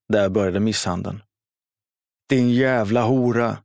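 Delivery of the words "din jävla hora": långsamt, hackat och något högre; ilsket skrik. A narrator's reading. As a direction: spotta fram varje ord i vrede. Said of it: "skrek Jari". (Jari skriker åt mamma medan han slår)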